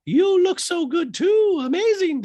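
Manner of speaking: very high, slow sweet mock voice; high mock mother's thanks; Rachel high voice. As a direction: coo it back in a lilting high voice. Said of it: "Rachel goes".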